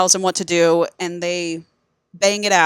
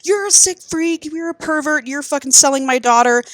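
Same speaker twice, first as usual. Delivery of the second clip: high mock-outraged insults; talking shit; 8 semitones higher than usual. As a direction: imitate an angry dad hurling accusations. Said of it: "talking shit to Emerson".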